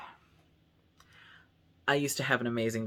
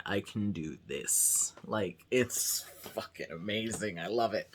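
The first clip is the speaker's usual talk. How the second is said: dropped voice; 4 semitones lower, 5 dB quieter than usual. Shown as a demonstration. Vlogger showing viewers how far the voice has dropped, low voice. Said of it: "It has been dropping".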